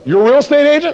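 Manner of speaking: high, loud, challenging question; incredulous homeowner. Acted. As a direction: throw it back at the caller in disbelief. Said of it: "And the person goes".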